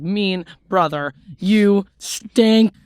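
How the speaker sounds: mock-angry, drawn-out kid insult; childish insult. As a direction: build to a loud final jab. Reading out her angry childhood message.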